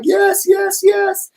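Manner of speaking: very high squealed yes yes yes; cheering crowd. Retold or imitated. Host imitates fans cheering, high and squealy. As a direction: play the whole crowd cheering at once. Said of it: "everybody's like".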